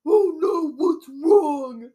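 high, loud, hammed-up alarm; silly rescuer voice. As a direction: play a comically alarmed guardsman. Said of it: "I don't know why I made him talk like".

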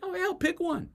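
very high-pitched, comic exasperation; high, squeezed mock impatience; exasperated urging. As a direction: cut in and urge them to just choose. Breaks off to urge the undecided.

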